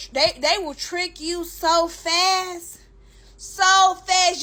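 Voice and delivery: so fast stretched slow, so pushed hard; slow emphasis. Real, not acted. Telling viewers how fast the men trick them, slow emphasis. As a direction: lean on so fast. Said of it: "And when I say".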